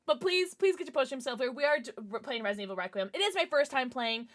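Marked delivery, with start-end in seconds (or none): none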